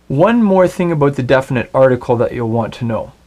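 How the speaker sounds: ordinary talk, nothing marked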